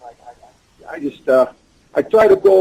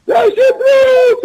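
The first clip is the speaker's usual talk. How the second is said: shouted high and loud, drawn out slowly; excited shout; 5 dB louder, much slower than usual. Retold or imitated. Kids at McDonald's shouting for their wrestling hero, excited. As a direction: call the wrestler's name like a thrilled fan. Kids call out his name.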